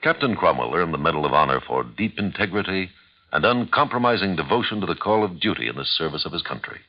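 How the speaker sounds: ordinary talk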